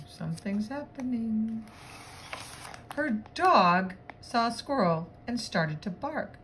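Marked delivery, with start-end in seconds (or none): 2.9-6.4 animated, bouncy picture-book reading; lively read-aloud voice; story narration. Acted. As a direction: read it to children with lively swoops and punched words.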